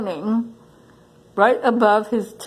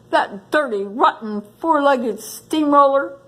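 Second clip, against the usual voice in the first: angry porcupine; 6 semitones higher than usual. Acted. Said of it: "his quills twitching in fury".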